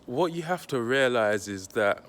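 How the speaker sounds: ordinary delivery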